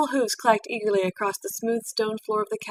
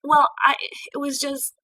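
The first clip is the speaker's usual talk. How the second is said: stammering; 6 semitones higher, 3 dB louder than usual. A narrator's reading. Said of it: "a stammering Twilight".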